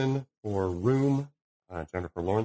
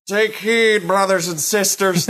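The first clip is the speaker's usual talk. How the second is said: mock Irish accent, higher and louder; 10 semitones higher, 7 dB louder than usual. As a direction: slip into a hammed-up Irish accent, preacher-like. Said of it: "his Irish side slips out".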